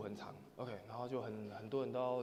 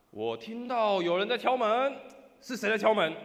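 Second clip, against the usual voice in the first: high, booming, drawn-out call; loud shout; 7 semitones higher, 12 dB louder than usual. Acted. Person inside challenging whoever knocks, loud shout. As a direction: demand loudly who is at the door. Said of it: "换里面人大声说".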